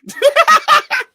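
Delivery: very high, loud falsetto giggle; cartoon mouse laugh. Mouse host after welcoming guests, shrill-pitched laugh.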